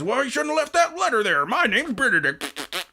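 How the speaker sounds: high-pitched mock whine; hammed-up, high imitation of a bickerer; mocking squabbler. Mimicking Benedict arguing over the wet cup, mocking.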